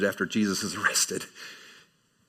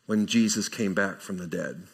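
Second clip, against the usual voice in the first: normal talking voice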